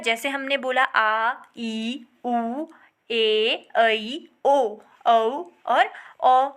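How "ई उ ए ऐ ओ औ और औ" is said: ई, उ, ए, ऐ, ओ, औ each held long and slow for a class; drawn-out vowels. Shown as a demonstration. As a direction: slowly stretch every vowel ई उ ए ऐ ओ औ. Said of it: "इनके उच्चारण में क्या लगा".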